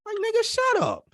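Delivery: high-pitched, incredulous put-down; mock dismissive retort. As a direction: squeal it in exaggerated disbelief. Mock retort to imagined boast.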